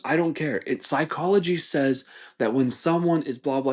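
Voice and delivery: high, insistent mock debater; heated arguing. Customer arguing psychology with his friend, heated. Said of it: "they're, like, yelling at each other".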